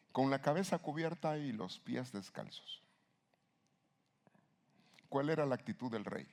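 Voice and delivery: ordinary talk, nothing marked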